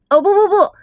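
high, loud quick "no no no"; a girl correcting herself, high and loud; playful correction. A narrator's reading. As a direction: catch yourself with a squeal.

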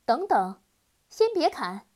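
loud, high cry to stop them; urgent shout. Acted. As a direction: shout to halt the blades. Girl stops the executioners.

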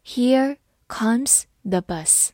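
Here comes the bus read slowly, word by word. Shown as a demonstration. Teacher slowly reading the sentence to students, slow and clear. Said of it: "我们慢慢来读".

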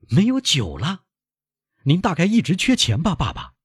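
high-pitched worried questioning; dismayed son. Acted. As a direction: question your father in rising alarm. Son dismayed at father's want.

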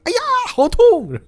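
very high, slow hammed-up ouch; overplayed cartoon cry of pain; comic yelp of pain. Thug punched across the room, very high hammed-up yelp.

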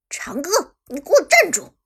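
loud, high call to stop; shouting. Acted. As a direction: yell after him to halt. Calling someone back from behind.

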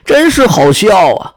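loud, mocking little lizard. A narrator's reading. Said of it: "他是个爱嘲讽别人的人".

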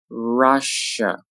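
"Russia" slowly, each syllable held; slow careful "Russia"; drawn-out model. Shown as a demonstration. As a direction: stretch "Russia" out for learners. Teacher models word slowly, then normally.

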